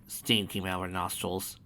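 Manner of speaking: plain reading voice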